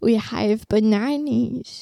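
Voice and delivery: slow, drawn-out put-on voice; TikTok impression. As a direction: stretch every word as a TikTok parody. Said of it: "My TikTok impression".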